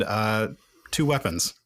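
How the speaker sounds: ordinary talk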